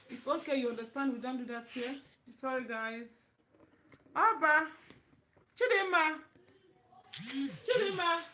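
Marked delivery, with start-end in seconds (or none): 4.1-8.2 loud, high call across to someone; raised voice, calling someone over. Real, not acted. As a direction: shout the name to summon them.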